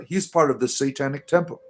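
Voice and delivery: ordinary delivery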